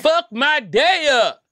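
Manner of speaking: high, slow, squeaky dare; stretched-out squeaky challenge; squeaky hype. Real, not acted. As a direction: squeal it out like a thrill-seeker's dare. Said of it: "don't think I heard anybody ever say some shit like that".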